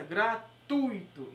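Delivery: "Gratuito" very slow, gra-TUI-to, "tui" as one syllable; "Gratuito" drawn out slowly with TUI stressed as a diphthong; slow correct pronunciation. Shown as a demonstration. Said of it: "a palavra correta é essa".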